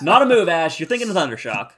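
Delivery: hammed-up telling-off; mock scolding. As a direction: scold the trainer in mock exasperation. Repeated acted scolding of Ash.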